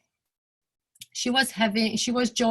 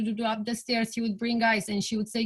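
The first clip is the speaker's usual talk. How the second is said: usual speaking voice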